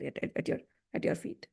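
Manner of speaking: whispered, breaking off; despairing gopis whispering at his feet; fading whisper. Acted. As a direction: let it die away to a whisper. Gopis' despairing plea fades out.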